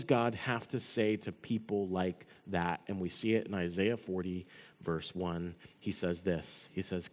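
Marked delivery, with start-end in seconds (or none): none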